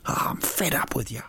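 hammed-up frustrated whisper; mock angry whisper. Host acting angry at his own fruit face, whispering. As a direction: hiss it at yourself in mock fury. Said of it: "when you're really angry with yourself".

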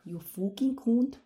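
loud, put-on Scottish accent; playful attempt at a Scottish accent. Shown as a demonstration. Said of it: "should I do my Scottish accent?".

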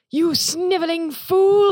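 high, drawn-out villain's cry; mock shout. Film reviewer imitating a villain shouting at the top of his lungs. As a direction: imitate a bellowing evil prince, stretching the words. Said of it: "he shouts at the top of his lungs".

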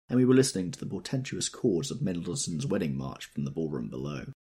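ordinary delivery, nothing marked

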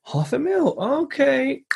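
high, greedy mock surprise. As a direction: act wide-eyed at the price. Builder reacting to the price.

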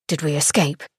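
low, smooth man's voice; a velvety male murmur; low velvet voice. A narrator's reading. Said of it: "came a low velvet voice".

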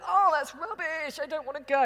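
mocking myself as a sulky teenager to the director; mock teenage sulk. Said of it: "I went very kind of teenage boy".

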